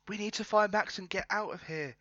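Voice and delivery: high, light woman's voice. Acted. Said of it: "That's, that's feminine".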